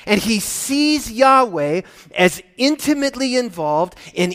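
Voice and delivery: energetic sermon voice, pressing forward; impassioned preaching. Real, not acted. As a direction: preach it eagerly, climbing in pitch. Preaches with rising force.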